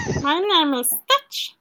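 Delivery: slow, lightly voiced alien creature; Stitch impression. She shows friends her Stitch voice, slow creature voice. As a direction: drag out each word like a small alien. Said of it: "I can do a Stitch voice".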